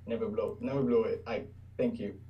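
normal talking voice

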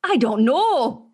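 put-on Perry's British accent. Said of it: "Can you say that in Perry's accent?".

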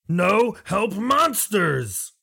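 high, very slow creature cry; alarmed high lizard call; lizard folk yell. Acted. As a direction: stretch out a high warning shout. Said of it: "the lizard will yell again".

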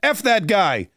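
mock finger-wagging rant, pitched high; Chris Christie scolding. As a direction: scold a rival in a hammed-up high voice. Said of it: "He's not gonna say".

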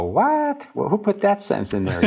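a sudden high yelp of "What?" then settling into puzzled indignation; mock surprise. Author rereading own story, startled by a sentence, mock surprise. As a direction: caught off guard by your own sentence.

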